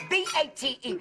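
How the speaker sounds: spelling it out letter by letter, B A T E; spelled out. Acted. Aunt mocks a misspelled sign, spelling out B A T E. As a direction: read the sign's misspelling as B A T E, letter by letter. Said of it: "Live Bait".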